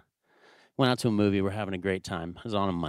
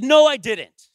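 loud, high-pitched dad yell; indignant parental outburst; raised angry voice; 14 semitones higher, 7 dB louder than usual. Retold or imitated. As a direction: shout your denial at your child, then scold. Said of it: "raising my voice at my child".

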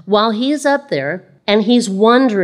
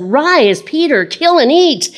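loud, higher proclamation; commanding divine voice; 8 semitones higher, 4 dB louder than usual. Acted. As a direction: boom out the command. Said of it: "a voice came to him and said".

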